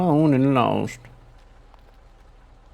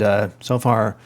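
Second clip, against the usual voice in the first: usual speaking voice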